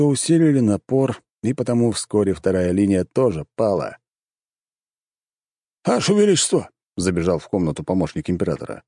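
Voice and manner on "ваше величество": high-pitched call to the emperor; urgent call. A narrator's reading. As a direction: burst in calling out, pitched high. Aide runs in calling the emperor. (взволнованный оклик)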